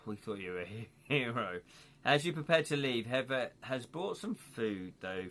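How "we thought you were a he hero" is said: a hint of a let-down village headman; mild sigh of disappointment; disappointed headman. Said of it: "shaking his head and tutting, going".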